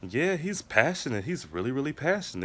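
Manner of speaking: imitating a coworker's backhanded praise; sweetly insincere, praising through a forced smile; passive-aggressive. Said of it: "she was being so passive-aggressive".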